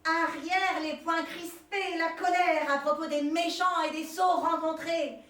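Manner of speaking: slow, loud, high declaiming; hammy stage actor. Acted. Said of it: "la déclame de plus en plus fort".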